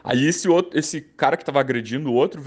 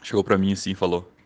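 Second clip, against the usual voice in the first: ordinary talk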